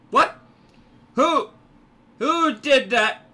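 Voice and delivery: loud, high, startled questions; incredulous outburst, slowing on the last question; indignant surprise. Real, not acted. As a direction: demand to know the culprit. Demanding who did something in game.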